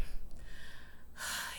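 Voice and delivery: long, soft, voiceless exhale of dread; weary sigh. Retold or imitated. Dreading the new testimony, a long weary sigh. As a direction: sigh like here comes trouble. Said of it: "And I'm like".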